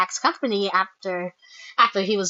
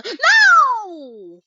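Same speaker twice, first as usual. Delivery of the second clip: long, high, wailed no; dramatic falling wail; loud protest; 11 semitones higher, 7 dB louder than usual. Real, not acted. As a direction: cry out in dismay, drawing it out. Protests a story development.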